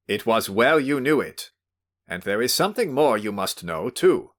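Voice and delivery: stern warning. Acted. Host warns him sternly.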